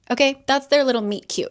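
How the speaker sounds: normal talking voice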